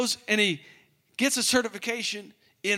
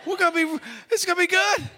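much higher, loud excitement; giddy host; 10 semitones higher, 3 dB louder, faster than usual. Retold or imitated. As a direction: squeal it with glee.

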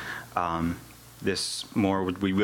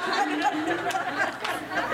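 long high laugh; real laugh. Real, not acted. Laughs at sleeping on a rock.

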